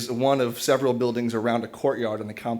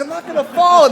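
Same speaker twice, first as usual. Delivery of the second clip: loud, high-pitched mock shout; retold yell; 10 dB louder than usual. Hanging man to yelling neighbor, loud mock shout. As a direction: act out an exasperated yell back, much higher. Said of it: "all of this yelling".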